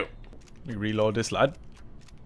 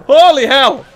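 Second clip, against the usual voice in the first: high, loud, desperate protest; panicked yelling; 6 semitones higher, 5 dB louder than usual. Real, not acted. Gamer out of ammo as hunter closes, panicked yelling. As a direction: yell in mounting panic.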